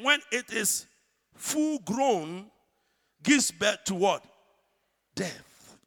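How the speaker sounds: loud, slow preached scripture reading; scripture preaching. Acted. Preacher reading James to congregation, loud and slow. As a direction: proclaim each verse slowly.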